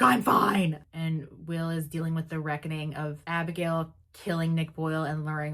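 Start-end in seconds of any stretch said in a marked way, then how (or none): 0.0-0.8 sudden loud, higher I'm fine; loud defensive insistence; defensive outburst. Retold or imitated. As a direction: bark it out in denial. Will denying he's sick.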